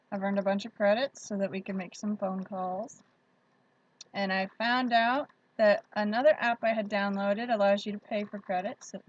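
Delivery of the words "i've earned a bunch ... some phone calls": ordinary delivery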